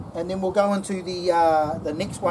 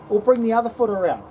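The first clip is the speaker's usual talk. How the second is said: usual speaking voice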